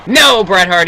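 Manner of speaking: excited call. Acted. Near-fall in commentary.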